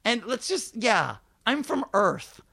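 high, loud sarcastic disbelief; incredulous rant. Retold or imitated. Comedian rants about the Disneyland question, high sarcastic disbelief.

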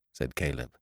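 usual speaking voice

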